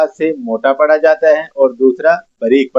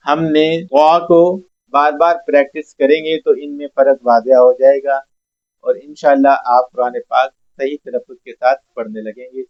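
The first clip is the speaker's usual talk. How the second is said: ordinary talk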